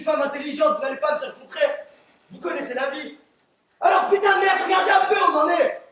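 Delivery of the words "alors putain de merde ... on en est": exasperated outburst. Acted. Juror swears in exasperation.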